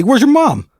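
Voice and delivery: high cooing pet voice; baby-talk to a puppy; talking to a dog. Retold or imitated. Neighbor cooing at a stray dog, high pitched. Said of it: "And he goes".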